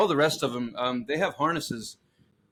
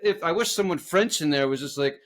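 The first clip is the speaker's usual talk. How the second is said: ordinary talk, nothing marked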